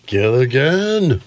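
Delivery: drawn-out, rising call; Skipper calling out. Acted. Playing the Skipper calling his first mate, slow rising holler. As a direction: stretch the name slowly upward in pitch.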